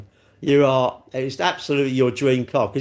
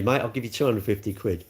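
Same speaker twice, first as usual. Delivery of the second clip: usual speaking voice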